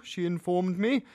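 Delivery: plain reading voice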